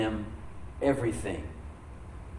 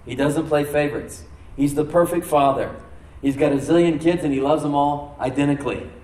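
rising, louder preaching on all things; fervent preaching; 2 semitones higher, 5 dB louder than usual. Acted. Pastor preaching God's gifts to the church, fervent and loud. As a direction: preach it out to the congregation. Preacher builds to full force.

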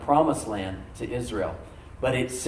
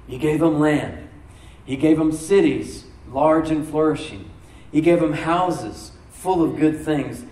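slow, louder preaching of each gift; slow emphatic preaching; 2 semitones higher, 4 dB louder, slower than usual. Acted. Pastor recounting God's gifts to the church, slow and emphatic. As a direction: hammer every gift home slowly.

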